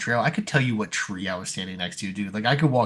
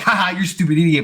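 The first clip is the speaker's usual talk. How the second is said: higher, louder mock jeer; mocking taunt; 7 semitones higher, 5 dB louder than usual. Teasing a crying sister, hammed-up mocking jeer. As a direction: taunt her like a bratty kid.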